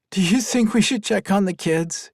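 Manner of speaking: plain reading voice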